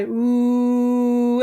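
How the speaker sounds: long, held, projected "ooh". Shown as a demonstration. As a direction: throw a sustained ooh into the distance. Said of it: "trying to throw our voice".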